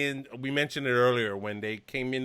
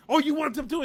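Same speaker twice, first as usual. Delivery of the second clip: cocky taunt; 14 semitones higher than usual. Retold or imitated. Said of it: "it's like".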